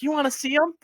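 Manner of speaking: a hint of an eager kid; little girl offering to show nunchucks. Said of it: "she's like".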